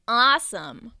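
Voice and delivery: loud, eager cheer; excited exclaim. Acted. Bears cheering Turtle on, loud and excited. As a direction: cheer it out together. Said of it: "the bears exclaimed".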